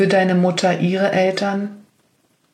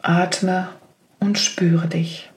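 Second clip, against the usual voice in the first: whispering; slower than usual. Acted. Meditation cue whispered.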